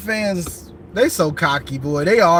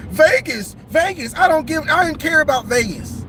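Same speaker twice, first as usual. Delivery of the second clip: high, whiny mock protest; a hammed-up, higher imitation of someone defending Vegas; mocking a critic; 8 semitones higher than usual. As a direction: squeak it out like a fussy commenter.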